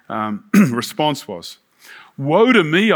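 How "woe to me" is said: raised, louder cry within a reading; lightly dramatised cry of woe. A narrator's reading. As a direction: read it as a cry of dismay. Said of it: "I cried".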